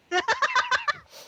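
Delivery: high squawking flamingo call. Pretend. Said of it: "He goes".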